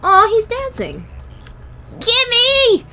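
high, loud cooing at something cute; delighted squeal. Real, not acted. Gamer spotting a cute axolotl, delighted high squeal.

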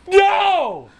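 high, drawn-out "no"; anguished cry. Real, not acted. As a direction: cry out against an awful choice. Forced to make a hard choice.

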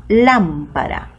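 slow "lámpara" with LÁM leaned on; stressed first syllable. Shown as a demonstration. Teacher showing the tonic syllable of "lámpara", stress on LÁM. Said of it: "es la que suena más fuerte".

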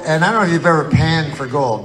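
ordinary talk

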